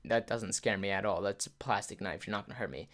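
low, soft, casual dismissal; unimpressed. Retold or imitated. Older self dismissing a plastic knife threat, low-key and unimpressed. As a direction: brush off the threat without effort.

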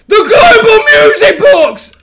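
very high, loud, slow scream; despairing scream. Real, not acted. Gamer realising the music box ran down, high slow wail.

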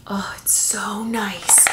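breathy whispered delight. Real, not acted. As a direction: whisper it, savoring it. Savoring the body butter.

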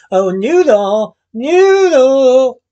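high, drawn-out sugary calling out; falsely sweet call hiding fury; sweet singsong call. A narrator's reading. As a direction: call sweetly, stretching the name high and loud. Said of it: "called sweetly".

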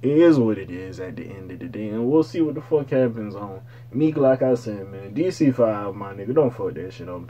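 ordinary delivery

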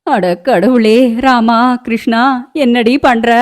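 dismayed, drawn-out exclamation; alarmed cry. Acted. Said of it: "அஞ்சு சத்தமா கத்த".

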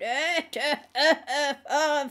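high, loud croaking cackle; croaking demon laugh. A narrator's reading. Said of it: "a croaking noise came from her throat".

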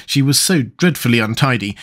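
ordinary delivery

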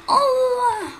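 high, loud cry half laughing; a screaming laugh-cry of shock; startled scream. Real, not acted. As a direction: shriek in shock at a bomb. Sudden death in game.

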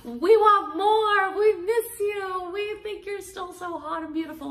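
high, loud gushing fan voice. Retold or imitated. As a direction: squeal it like adoring fans begging her back. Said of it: "people are like".

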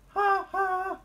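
very high, loud double toot; piercing high wail; imitated whistle. A narrator's reading.

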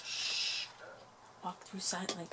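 hushed whisper. Real, not acted. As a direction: whisper softly. Whispered instruction, unvoiced.

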